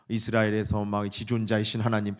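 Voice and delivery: low monotone pious address; mock formal prayer on an even note; formulaic prayer. Shown as a demonstration. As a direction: drone it by rote, feelings held back. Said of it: "근데 이렇게 기도한다".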